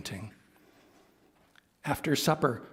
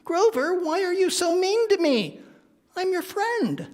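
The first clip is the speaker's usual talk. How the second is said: very high, hurt bird voice; hurt Big Bird. Acted. Big Bird tearfully asking Grover why, high hurt voice. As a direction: plead with your friend. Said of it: "eyes filled with tears".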